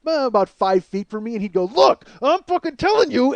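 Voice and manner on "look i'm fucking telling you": higher, imitated angry yelling; hammed-up shout in someone's face; mock scream. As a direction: act out a brother blowing up. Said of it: "he would start screaming".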